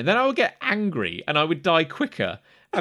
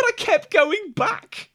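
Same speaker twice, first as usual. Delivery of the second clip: broken; 12 semitones higher, much slower than usual. Real, not acted. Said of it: "I was so broken".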